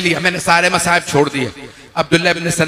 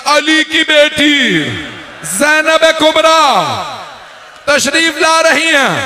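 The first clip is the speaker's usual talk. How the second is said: higher, slower keening announcement; drawn-out mourning call; rising lament cry; 7 semitones higher than usual. Acted. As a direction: build the grief as you call her name.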